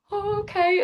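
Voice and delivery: high-pitched, stunned agreement; taken aback. Retold or imitated. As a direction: act out being caught off guard, voice jumping up. Retelling her stunned reply to Klopp.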